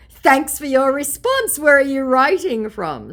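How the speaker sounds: lightly voiced chirpy scammer; mock scammer voice. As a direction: ham up the fake politeness. Reading scammer's reply.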